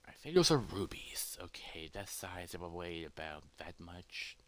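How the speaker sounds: whispered thinking aloud; very soft, hushed calculation; murmuring to oneself. Acted. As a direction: whisper the estimate under your breath. Character estimates to himself.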